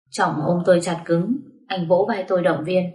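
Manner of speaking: ordinary delivery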